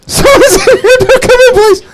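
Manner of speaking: frantic squeaky falsetto pleading; mock scream. Man on the toilet begging them out, high squeaky scream. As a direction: shriek it very high, cartoon panic. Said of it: "I kept screaming".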